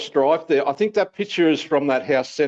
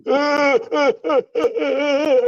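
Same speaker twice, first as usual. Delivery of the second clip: high cackling laugh; 12 semitones higher, 3 dB louder than usual. Real, not acted.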